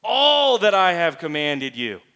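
"All" long, loud and emphatic; stress on ALL. Shown as a demonstration. Preacher repeating the key word for listeners, stress on "All". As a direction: punch "All" and let it ring out slowly. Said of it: "kinda put some inflection on".

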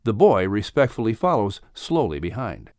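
usual speaking voice

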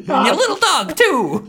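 high, loud cackling witch threat; hammed-up witch line; wicked witch voice. Quoting the Wicked Witch, laughing.

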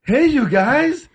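mock the singer's corny crowd greeting; cheesy band banter. Mocking the band's singer greeting the crowd, high and cheesy. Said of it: "and they go".

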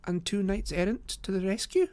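put-on high-pitched young lady voice; Miss Morstan, admiring. Lady adds her rescuers to the tale, high voice. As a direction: lift it high as a light addition.

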